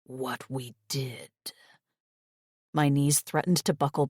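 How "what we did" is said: low, slow whisper in the ear. A narrator's reading. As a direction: breathe it slowly into her ear. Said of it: "whispering in my ear".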